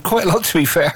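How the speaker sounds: a teasing laugh, half out of the words. Real, not acted. Radio host teasing his guest, laughing as he talks.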